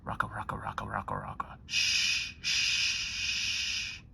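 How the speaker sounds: whispered rocka rocka then shh; lulling whisper. Acted. Ending bedtime story, whispered rocking hush.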